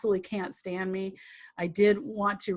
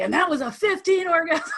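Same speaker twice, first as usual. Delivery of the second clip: boastful partner; 8 semitones higher, 6 dB louder than usual. Retold or imitated. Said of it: "so that he can say".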